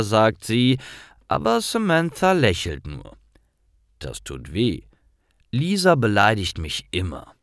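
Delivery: ordinary delivery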